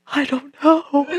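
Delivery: trembling schoolgirl; dazed girl. Retold or imitated. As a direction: voice the shaken girl, lost for words.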